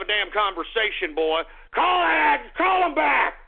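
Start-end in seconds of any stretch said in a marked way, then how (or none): 1.7-3.4 high, loud impatient command; angry yelled order. Real, not acted. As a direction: shout the order at your engineer. Yells order to engineer.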